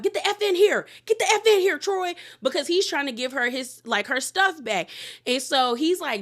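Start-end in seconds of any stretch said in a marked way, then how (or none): 0.0-2.2 high, loud screamed order; wife screaming at husband to come in, high and loud; mock yell. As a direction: scream him back into the room. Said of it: "talking about".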